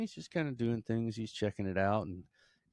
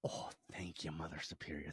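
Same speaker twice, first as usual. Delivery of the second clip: hushed thanks; 5 semitones lower, 11 dB quieter than usual. Acted. Leprechaun thanking Mother Superior after a no, low and hushed. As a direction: let it sink away softly.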